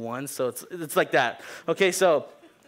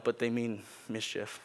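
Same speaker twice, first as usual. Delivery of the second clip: usual speaking voice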